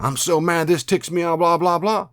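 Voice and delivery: high-pitched, worked-up grumbling to yourself; mad self-talk. Acted. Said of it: "if you're saying on the inside".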